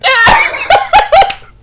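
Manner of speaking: high, loud peal of laughter; loud cackling laugh. Real, not acted. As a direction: crack up at the joke. Cracks up at plumbing confession.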